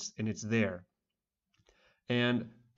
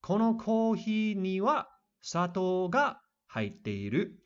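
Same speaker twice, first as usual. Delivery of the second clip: higher, slow careful reading of the Japanese; example sentence read slowly; 8 semitones higher, slower than usual. Shown as a demonstration. Teacher reading an example sentence to students, slow and high. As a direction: read it out like a textbook model line. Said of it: "here we have another example sentence".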